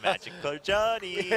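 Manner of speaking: lightly imitating a Korean accent on magical journey; slow Korean caster accent on magical journey. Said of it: "just say magical journey with a Korean accent".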